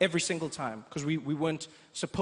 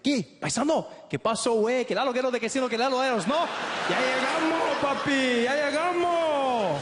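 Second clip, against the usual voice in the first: high, loud Mexican Spanish, slowing at the end; Mexican accent; 8 semitones higher, 4 dB louder than usual. Retold or imitated. Stranger in the airport hailing Trevor, loud Mexican Spanish accent. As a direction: greet him like a long-lost countryman. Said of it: "He's like".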